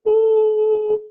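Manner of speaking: a sudden high, loud hooting ooh; high ooh sound. Shown as a demonstration. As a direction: let out a piercing high ooh to show a voice. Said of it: "do something like".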